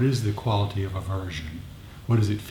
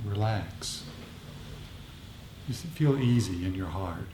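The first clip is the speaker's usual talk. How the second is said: softer, unhurried meditation voice; guided meditation; 7 dB quieter than usual. Acted. Teacher guiding the group into metta practice, softer and unhurried. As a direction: ease the group into calm.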